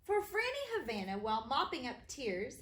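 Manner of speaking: ordinary delivery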